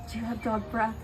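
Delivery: ordinary delivery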